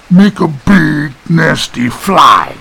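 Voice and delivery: slow, loud simpleton voice; big slow character voice; simple-minded Eustace. Acted. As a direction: announce it slowly and loudly, word by word. Simple Eustace explains his job.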